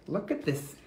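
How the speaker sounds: plain reading voice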